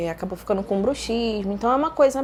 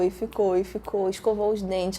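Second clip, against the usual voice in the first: normal talking voice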